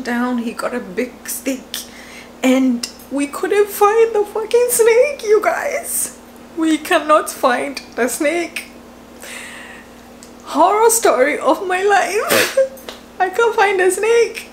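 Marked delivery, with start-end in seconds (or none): 2.4-8.6 high wailing complaint; whiny half-cry. Real, not acted. Telling viewers they lost the snake, high whiny wail. 10.5-14.5 laughing despair. Real, not acted. Laughing despair about lost snake.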